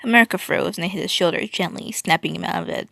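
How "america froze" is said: plain reading voice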